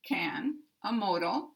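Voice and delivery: usual speaking voice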